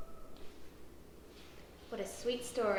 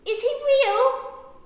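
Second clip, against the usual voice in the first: loud, high, drawn-out question; amazed child; 13 semitones higher, 13 dB louder, slower than usual. A narrator's reading. Ruby finds the puppy and asks, amazed. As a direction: ask in slow, high wonder.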